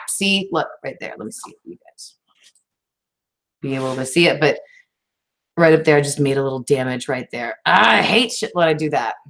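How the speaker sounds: ordinary delivery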